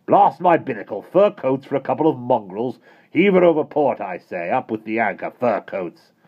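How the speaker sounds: high, loud blustering; sailor's rant. A narrator's reading. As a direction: bluster and curse. Admiral ranting.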